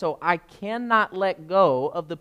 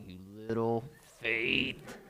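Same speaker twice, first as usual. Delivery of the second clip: condescending rebuke; 7 semitones lower, 10 dB quieter, slower than usual. Pretend. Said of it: "I think we're like".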